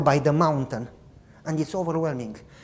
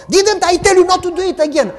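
high, loud, fast fake scolding; hammed-up angry father; mock scolding; 12 semitones higher, 8 dB louder, faster than usual. Father pretending to punish his son, loud mock scolding.

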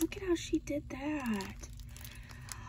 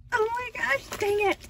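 excited; 8 semitones higher, 6 dB louder, faster than usual. Real, not acted. Squealing at another gift.

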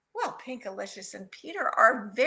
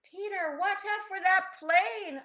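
storybook yell, held back; yelled warning; 7 semitones higher than usual. A narrator's reading. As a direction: call a warning without full volume. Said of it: "I yelled".

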